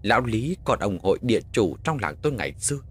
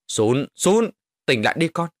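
raised, higher-pitched rousing call; urgent waking call; 5 semitones higher, 5 dB louder than usual. Acted. Father waking his son from a nightmare, loud urgent call. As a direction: call a sleeper awake.

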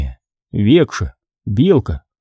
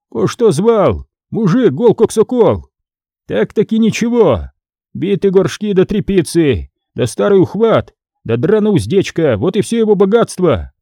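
higher, dismissive merchant listing junk; scornful merchant; 7 semitones higher than usual. A narrator's reading. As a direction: scoff at a poor man's goods.

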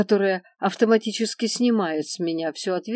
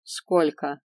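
indifferent; 2 semitones lower than usual. A narrator's reading. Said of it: "равнодушно уточнил мессир".

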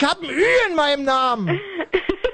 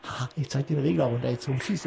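much softer, lower, sly aside; conspiratorial hush; 10 semitones lower, 8 dB quieter than usual. Real, not acted. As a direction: whisper a mean little secret. Host fades caller, whispering mischief.

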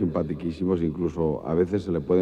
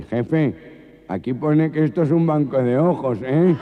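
higher, louder alarmed robber; nasal robber voice; 7 semitones higher, 5 dB louder than usual. Acted. Robber alarmed to his boss, hammed-up gangoso voice. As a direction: blurt out the discovery in shock. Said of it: "tres gangosos".

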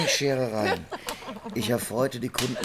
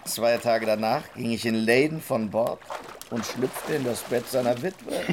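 usual speaking voice

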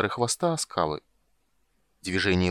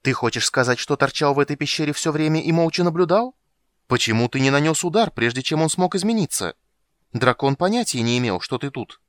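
higher, louder angry accusation; 7 semitones higher, 6 dB louder than usual. A narrator's reading. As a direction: demand answers, furious. Warrior furious at the ghost.

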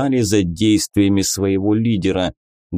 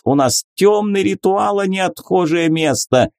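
angry priest; 6 semitones higher than usual. A narrator's reading. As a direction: threaten him with rising fury. Priest threatening the vampire.

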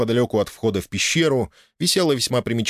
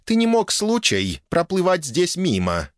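put-on elf girl voice; 9 semitones higher than usual. A narrator's reading. Elf presses the stranger.

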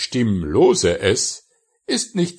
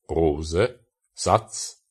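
ordinary talk, nothing marked

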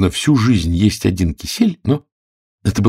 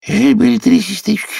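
quick sleepy Dormouse; 4 dB louder than usual. A narrator's reading. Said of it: "быстро начала Соня".

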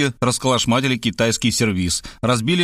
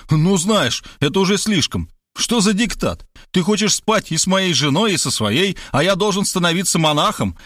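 raised, heated protest; furious outburst; 6 semitones higher than usual. A narrator's reading. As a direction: snap back in rising anger. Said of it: "Я тоже взбесился".

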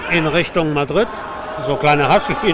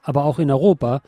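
even, measured newsreader delivery; news read; 3 semitones lower, 5 dB quieter than usual. Acted. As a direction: read it like a radio news bulletin. News bulletin read by anchor. (Nachrichtensprecher-Ton)

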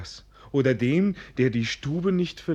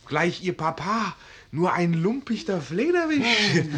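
ordinary delivery

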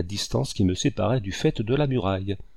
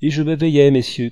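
loud, high punchline reveal; triumphant announcement; 5 semitones higher, 8 dB louder than usual. A narrator's reading. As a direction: burst out with the twist. Storyteller delivers punchline after suspense.

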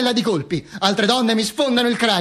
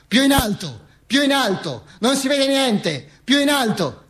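higher, slow crowd call; crowd demanding; 7 semitones higher than usual. Retold or imitated. The crowd demands the bodies be raised higher. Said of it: "gridava la gente".

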